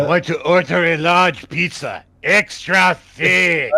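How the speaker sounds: loud, high comic shout; shouted joke order. Pretend. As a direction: bellow it like a pushy customer. Joking pizza order, shouted.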